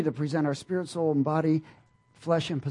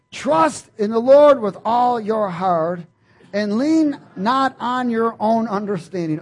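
loud, slow, higher declaration; proclaiming scripture; 5 semitones higher, 9 dB louder, slower than usual. Acted. Preacher proclaims Proverbs three loudly and slowly to the church. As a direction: lay each word down like a proclamation.

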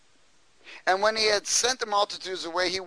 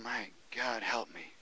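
hushed pleading prayer, much softer; quiet plea; 6 semitones lower, 13 dB quieter than usual. Retold or imitated. Jesus praying alone, a soft pleading. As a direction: breathe it low like a weary prayer. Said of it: "I'm sure he kept going".

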